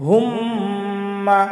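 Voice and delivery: হুম্মা with a long nasal hum on the doubled ম; ghunna on mim. Shown as a demonstration. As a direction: hold the ম of হুম্মা through the nose. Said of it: "মিমের ওপরে যদি তাসদিদ থাকে". (হুম্মা-তে গুন্না করে)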